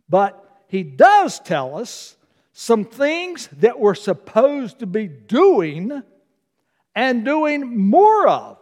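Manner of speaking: slow, deliberate preaching; slow emphatic. Real, not acted. Pastor stressing what believers must do, slow and deliberate. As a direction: lay out each word for weight. Emphasizing duties as end nears.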